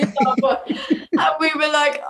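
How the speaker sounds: words breaking into laughter; real giggle while telling it; laughing. Real, not acted. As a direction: laugh through it, amused. Laughing about casting the boss.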